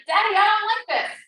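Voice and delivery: put-on a touch of a sulky child; complaining child. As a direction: complain like a bored little boy. Annoyed little brother complains.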